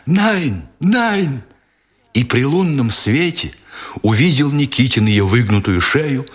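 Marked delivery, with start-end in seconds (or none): 0.1-1.4 thin high wail of pain; plaintive cry. A narrator's reading. German woman with her wrist crushed, high plaintive cry. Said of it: "жалобно вскрикнула".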